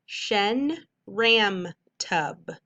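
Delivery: Shen ram tub stretched out very slowly syllable by syllable; teacher blending a made-up word slowly. Shown as a demonstration. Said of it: "Whole word".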